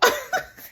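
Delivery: very high short laugh; high squeal of laughter. Real, not acted. Laughs at "Dumb and Dumber.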